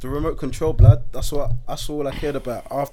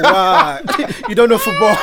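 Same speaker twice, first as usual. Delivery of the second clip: laughing mock dad; 6 semitones higher, 5 dB louder than usual. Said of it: "be like".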